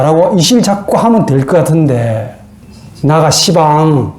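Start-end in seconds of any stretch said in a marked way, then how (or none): 3.0-4.1 slow, drawn-out Jeolla comeback; Jeolla dialect. Acted. Cain dodging God's question, in drawling Jeolla dialect. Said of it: "전라도 버전으로 말하면".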